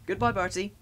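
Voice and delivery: high, cheery farewell call; lifted voice, hailing in passing. A narrator's reading. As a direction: call goodbye with a rising end. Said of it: "called Thomas".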